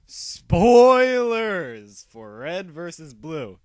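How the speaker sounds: very loud, long drawn-out spoiler alarm; high, booming announcement, first word stretched; shouted warning. Real, not acted. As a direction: holler it slowly like a siren.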